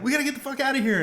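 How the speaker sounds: high, loud, very fast alarm; acted urgent warning. Host as a hero warning the planet's ruler, urgent alarm. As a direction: blurt it out like a mock panicked evacuation. Nova warning of coming danger.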